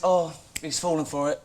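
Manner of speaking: ordinary talk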